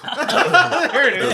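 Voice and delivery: shrieking with laughter; loud high laughing; laughing hard. Real, not acted.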